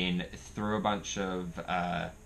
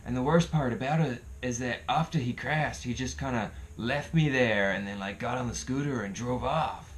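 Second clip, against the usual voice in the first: higher-pitched put-on voice of another bloke; injured guy retelling; 6 semitones higher than usual. Injured guy explaining his scooter crash, imitated in a higher voice. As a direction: mimic a battered guy telling his woes. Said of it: "And he's like".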